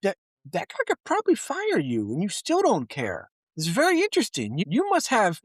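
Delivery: high incredulous sass; sassy disbelief. Retold or imitated. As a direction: needle him with fake wonder. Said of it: "you're like".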